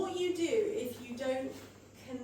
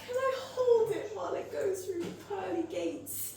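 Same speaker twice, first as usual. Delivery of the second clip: put-on gasping child; 7 semitones higher than usual. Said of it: "my daughter's like".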